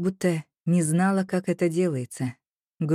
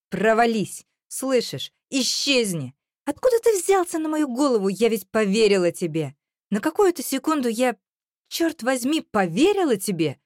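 high-pitched angry tirade; furious outburst; 6 semitones higher than usual. A narrator's reading. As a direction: lash out, voice pitched right up. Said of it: "овладела настолько неодолимая злость".

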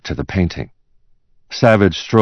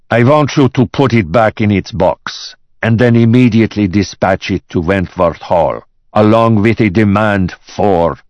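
raised, commanding boss voice; angry boss; 5 semitones higher, 5 dB louder than usual. Acted. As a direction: issue orders angrily to the secretary. Enraged boss orders his secretary.

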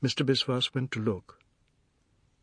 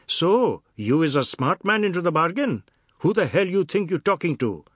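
loud, raised-pitch indignation; angry fat man; 7 semitones higher, 7 dB louder than usual. Acted. Fat man challenges a cheeky boy, loud and indignant. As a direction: round on the cheeky child, loudly.

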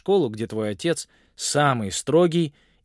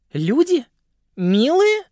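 incredulous; 14 semitones higher, 8 dB louder, slower than usual. A narrator's reading. Viola scoffs at humans.

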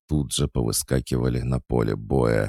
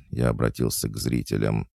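ordinary delivery

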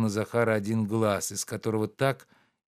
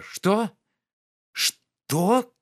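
much higher, louder menacing demand; грозно; 11 semitones higher, 5 dB louder than usual. A narrator's reading. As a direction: threaten the servant. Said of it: "грозно заговорил Обломов". (барин грозно переспрашивает «Что? Что?»)

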